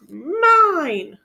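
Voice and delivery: high, loud drawn-out wail; long whiny wail of mine. Acted. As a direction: wail it in a tug of war. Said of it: "he wailed".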